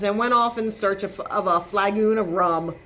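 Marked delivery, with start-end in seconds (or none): none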